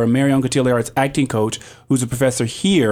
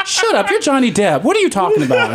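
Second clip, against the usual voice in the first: high-pitched, laughing incredulity; mock disbelief; 10 semitones higher than usual. Starstruck singer silently answering Johnny Depp, high mock disbelief. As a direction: replay a disbelieving thought, pitched way up. Retelling her disbelief at star's reaction.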